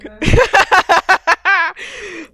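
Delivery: long, loud, high laugh; real laugh. Real, not acted. Host laughing at friends letting them take the lead, loud high laugh. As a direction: burst out laughing in a run. Laughs at co-hosts' hesitant answers.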